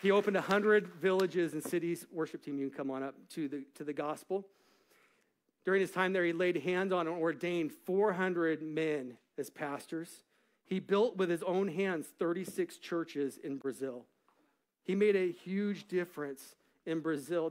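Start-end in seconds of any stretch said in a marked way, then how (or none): 0.0-2.1 loud, high preaching climax; full-force preaching. Acted. Preacher lifting his great-grandfather's story to the congregation, loud preaching. 5.6-17.5 full-force preaching. Acted. Preacher lifting his great-grandfather's story to the congregation, loud preaching.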